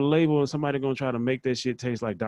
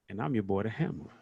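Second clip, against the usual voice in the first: ordinary talk, nothing marked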